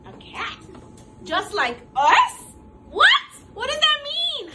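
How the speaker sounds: rising into a high, loud, squealing what; shocked animals. Acted. Story animals reacting in shock, building to a high shout. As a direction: disbelief growing to a high shout. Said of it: "They're like".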